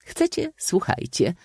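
high-pitched playful invitation; comic old maid. A narrator's reading. Old maid agreeing to give her definition, comic. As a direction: call for attention in a comic high voice. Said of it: "Strojąc komiczny grymas".

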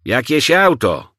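high, loud, drawn-out shout; driver's slow bellowed reply; yelling. A narrator's reading. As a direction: yell back over the engine noise. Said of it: "wrzasnął".

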